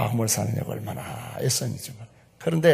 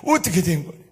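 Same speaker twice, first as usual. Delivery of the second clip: astonished mother; 5 semitones higher, 5 dB louder than usual. Retold or imitated. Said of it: "장모님이 일어나서".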